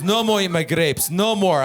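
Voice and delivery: emphatic accented dad impression; broken-English father laying down the law; dad's foreign accent. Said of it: "my dad can't speak good English".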